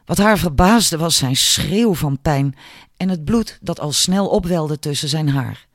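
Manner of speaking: ordinary delivery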